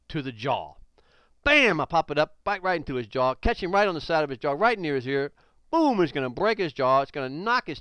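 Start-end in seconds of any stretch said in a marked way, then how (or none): 1.4-1.8 a sudden, high, loud "Bam!"; shouted impact sound. Shown as a demonstration. Teacher acts out the kick landing, high, loud shout. 5.7-6.0 a sudden, high, loud "Bam!"; shouted impact sound. Shown as a demonstration. Teacher acts out the kick landing, high, loud shout.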